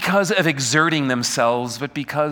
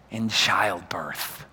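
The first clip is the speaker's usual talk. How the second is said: breathy whispered, slow and soft; hushed whisper; 10 semitones lower, 7 dB quieter, much slower than usual. Acted. Preacher likens crying out to childbirth, hushed whisper. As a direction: let it fall to a whisper. Preacher dramatizes a woman's gasp.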